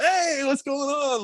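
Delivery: high, drawn-out imitation of a friendly hello; cheery greeting. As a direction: mimic a coach suddenly perking up. Coach's unexpectedly cheerful greeting imitated.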